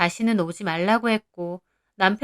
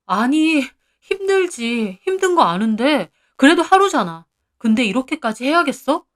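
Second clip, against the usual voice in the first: exasperated husband; 4 semitones higher, 4 dB louder than usual. Acted. 남편이 아내를 달래며 다툼.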